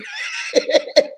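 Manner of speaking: high squealing laugh; a burst of high laughter. Real, not acted. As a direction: let out a shrill laugh.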